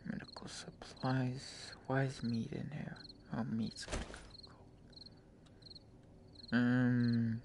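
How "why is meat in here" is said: ordinary delivery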